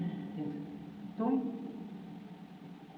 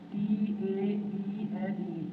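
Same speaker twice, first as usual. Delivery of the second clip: letters spelt out slowly one by one; teacher spelling a word for pupils; much slower than usual. Shown as a demonstration. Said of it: "spelling out the word".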